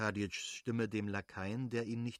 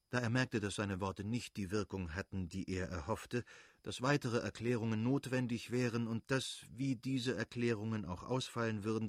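ordinary delivery

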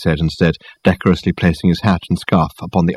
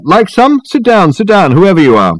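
high, raised-voice welcome; calling out; 11 semitones higher, 4 dB louder than usual. A narrator's reading. Reg offering tea from the kitchen, calling out. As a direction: call through to unseen guests. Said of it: "he called out".

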